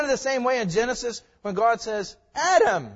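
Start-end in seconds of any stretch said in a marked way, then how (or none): 2.3-2.9 calling out. Pretend. God calling Adam out of hiding, a loud call. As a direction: call the name loud and high, a summons.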